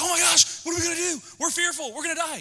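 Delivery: high, fast mock panic. Preacher mimicking fearful people, high and fast. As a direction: squeal it like a frightened crowd. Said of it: "So people are like".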